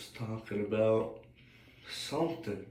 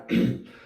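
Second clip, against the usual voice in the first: short, loud throat clear; 6 dB louder than usual. Real, not acted. Speaker breaks off mid-sentence, clearing a scratchy throat.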